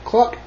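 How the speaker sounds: short, high cluck; chicken cluck. A narrator's reading. Chicken greeting Blue, high short cluck. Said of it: "said a chicken".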